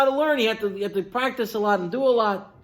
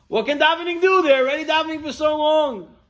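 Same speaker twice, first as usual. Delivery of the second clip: high, louder, incredulous protest; protesting question; 9 semitones higher, 4 dB louder, faster than usual. Retold or imitated. Questioner challenging Rav Steinman on davening, high protesting voice. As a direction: push back in raised, exasperated pitch.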